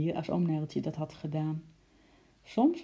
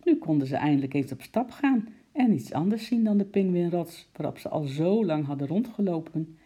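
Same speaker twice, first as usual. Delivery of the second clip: plain reading voice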